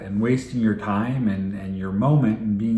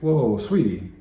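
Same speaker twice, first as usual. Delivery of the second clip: gentle connecting; 5 semitones higher than usual. Shown as a demonstration. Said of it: "you slow down and you connect".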